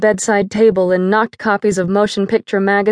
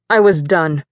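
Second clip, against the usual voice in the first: usual speaking voice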